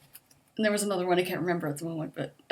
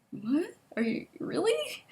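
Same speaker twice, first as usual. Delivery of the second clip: mock disbelief, rising high; imitating a teacher's shocked "really?"; incredulous teacher; 9 semitones higher than usual. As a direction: pitch shoots up in disbelief. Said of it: "she gave me this look like".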